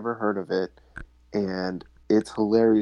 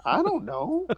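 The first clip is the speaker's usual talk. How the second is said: put-on high, small child voice; little kid with no answer; clueless kid; 13 semitones higher than usual. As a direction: squeak it like a stumped kid.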